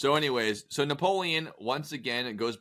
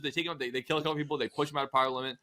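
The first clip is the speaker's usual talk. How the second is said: usual speaking voice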